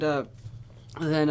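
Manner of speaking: usual speaking voice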